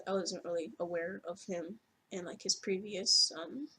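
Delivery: ordinary talk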